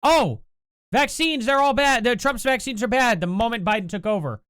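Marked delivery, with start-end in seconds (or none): 0.0-3.2 mocking critics. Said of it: "Remember whenever Trump came out".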